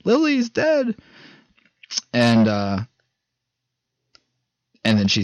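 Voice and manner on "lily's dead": high, shrill-pitched panic cry of a dead friend; mock screaming panic. Girl runs in screaming to the camp, panicked mock scream. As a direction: squeal it out like a horror victim. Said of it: "runs inside screaming".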